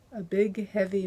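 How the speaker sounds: ordinary delivery, nothing marked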